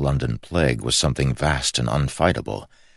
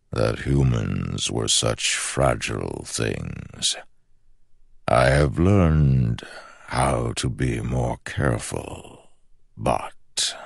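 slow ancient shadow voice; 2 semitones lower, slower than usual. Acted. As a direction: speak like a patient shadow god. The shadow speaks.

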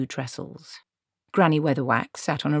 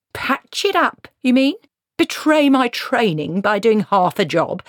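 high, louder, indignant questions; scandalised outrage; 8 semitones higher, 5 dB louder than usual. A narrator's reading. Dwarf protesting to Granny about half a job, scandalised. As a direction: protest as an affronted craftsman, pitch soaring.

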